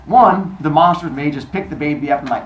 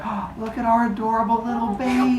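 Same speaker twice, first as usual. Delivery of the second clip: cooing; 7 semitones higher, 5 dB quieter than usual. Retold or imitated. Wife coos over baby.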